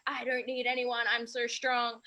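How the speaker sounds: lightly acted bravado; mock self-sufficiency. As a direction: put on a show of needing nobody. Mock quote of someone isolating themselves.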